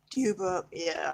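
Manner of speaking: plain reading voice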